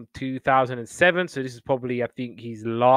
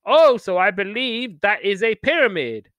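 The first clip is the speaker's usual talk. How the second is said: presenter impression; 7 semitones higher, 5 dB louder than usual. Mimicking presenter's quoted line.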